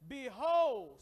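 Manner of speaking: a big loud Behold; loud call. Real, not acted. Pastor leads the church in reading Behold, loud. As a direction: call it out to the room. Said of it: "Let's read that together".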